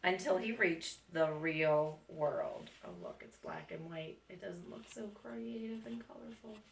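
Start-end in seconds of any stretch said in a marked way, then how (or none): none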